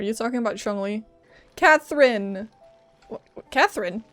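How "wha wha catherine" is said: normal talking voice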